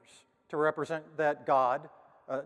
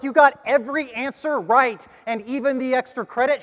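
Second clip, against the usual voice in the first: much higher and louder, gushing praise; thrilled teacher; 10 semitones higher, 10 dB louder than usual. Retold or imitated. Teacher praising Tommy in front of parents, loud gushing delight. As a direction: burst out with delight at the student.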